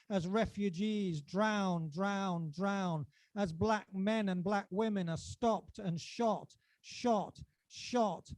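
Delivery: rising and falling spoken-word recital, charged; performance poetry. Acted. Poet reciting his poem to a live crowd, dramatic. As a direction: declaim each line to the audience like a manifesto.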